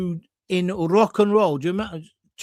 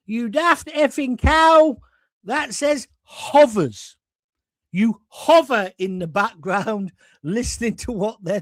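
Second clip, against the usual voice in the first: loud, slow, higher scolding; exasperated director; 4 semitones higher, 4 dB louder, slower than usual. Retold or imitated. Director correcting the cleaner's reading, loud slow scolding. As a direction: spell it out with contempt. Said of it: "he goes".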